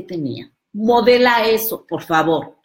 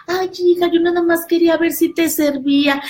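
meek mother; 11 semitones higher, 4 dB louder than usual. Retold or imitated. Mother timidly coaxes her daughter, high meek voice. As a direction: coax the child timidly.

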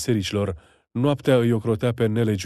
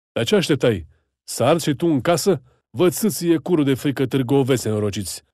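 higher, louder contemptuous rebuke; scolding gendarme; 4 semitones higher, 4 dB louder than usual. Acted. Gendarme berating the townsman for hiding, loud angry scolding. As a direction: tear into the coward townsman. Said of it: "Un jandarm îl luă la rost".

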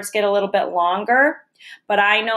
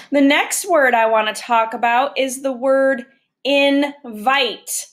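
ordinary talk, nothing marked

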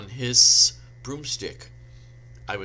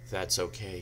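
low, soft, downcast reply; understated gloom, voice sinking; depressed; 4 semitones lower, 5 dB quieter than usual. A narrator's reading. Draco forgiving the heroine, all depressed. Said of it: "all depressed".

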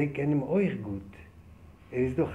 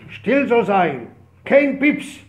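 soldiers' command; 6 semitones higher, 10 dB louder, much slower than usual. Retold or imitated.

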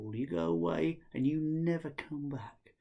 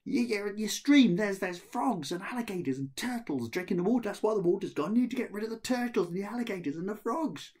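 loud, much higher calling; 10 semitones higher, 7 dB louder than usual. Acted. Ahmed calling to the second brother, loud. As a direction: shout the warning across the stream.

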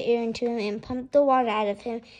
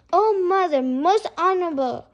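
higher, louder breathless appeal; panting plea; 6 semitones higher, 7 dB louder than usual. A narrator's reading. Boy appeals to his mother after running, panting. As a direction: cry out to mother as if winded. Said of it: "he panted".

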